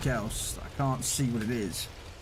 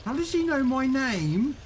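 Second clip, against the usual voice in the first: put-on higher, slow, wondering child voice; imitating a puzzled kid; 13 semitones higher, 4 dB louder, slower than usual. Mate's son puzzled the streamer knows him, childlike and slow. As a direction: play the baffled little boy. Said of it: "his son's looking there going".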